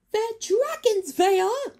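very high, loud, slow frightened squeak; terrified. A narrator's reading. Creature terrified of the dragons, high and loud. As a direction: cry it out in terror. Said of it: "said a terrified Sproggle".

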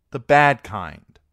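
loud, slow cheeky snap; defiant retort. Acted. Arya answering Gendry, loud and defiant. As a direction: throw it back at him.

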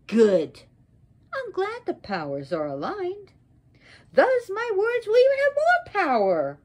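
loud GOOD, then high and ringing; wrathful menace. Acted. Sarasvati warns Brahma before cursing him, wrathful menace. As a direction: build to a high, forceful threat. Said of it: "And angry".

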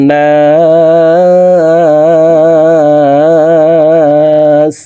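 high, very slow melodic chant; Quran recitation. Shown as a demonstration. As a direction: stretch every syllable in recitation. Recites with madd.

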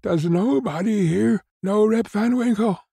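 high-pitched plea in despair; desperate, high-pitched appeal; despairing cry. A narrator's reading. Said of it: "cried out in despair".

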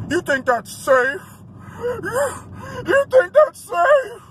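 slow, high, crying whine imitating him; mock whiny voice. Mimicking the angry driver, whiny and high. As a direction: whimper it like a sore loser. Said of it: "this motherfucker was like".

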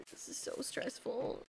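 breathy whisper to herself; hushed worried whisper; whispered stress. Real, not acted. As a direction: whisper it under pressure. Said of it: "this is stressful".

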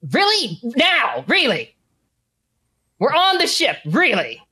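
high-pitched disbelief, falling on each word; incredulous protest. Acted. Game master as an accomplice on the ship, incredulous protest. Acted villain protests the order.

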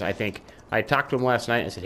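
usual speaking voice

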